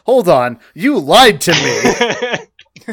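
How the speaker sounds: slow, laughing mock outrage; laughing accusation. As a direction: drag it out through a laugh.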